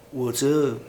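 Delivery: low, soft New York greeting; badly faked New Yorker; half-hearted New York accent, dropped low. Shown as a demonstration. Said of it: "that's a bad New York accent".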